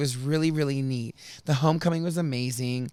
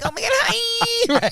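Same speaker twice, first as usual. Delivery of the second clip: much higher, drawn-out squealing Oh my God hi; gushing LA greeting; 5 dB louder, slower than usual. Retold or imitated. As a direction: gush like a Los Angeles influencer. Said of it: "I'll be like".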